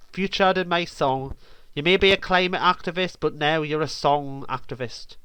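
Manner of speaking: usual speaking voice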